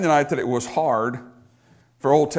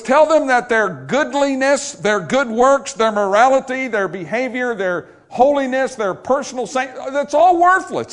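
high, emphatic pulpit declaration; full-force preaching; 7 semitones higher, 4 dB louder than usual. Acted. God telling the prophet what to proclaim, full-force preaching. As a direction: hammer out the list phrase by phrase.

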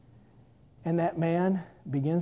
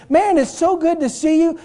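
much higher excited greeting; blind man delighted; 11 semitones higher, 8 dB louder than usual. Acted. Blind man thrilled to see the healed woman, high and gushing. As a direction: gush over seeing her again.

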